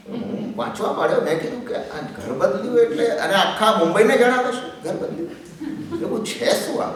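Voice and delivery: breathy laughter through the words; laughing. Real, not acted. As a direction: chuckle as you tell it, half whispering. Reading absurd news, laughing. (હસતાં હસતાં બોલવું)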